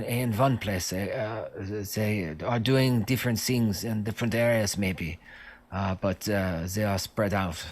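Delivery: put-on halting, accented prisoner voice; foreign-accented guard, full of ums; hesitant captive guard. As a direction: feel your way through a vague answer. Plays the captive guard answering.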